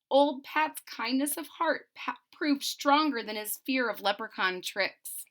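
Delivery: plain reading voice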